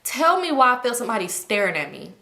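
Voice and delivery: ordinary talk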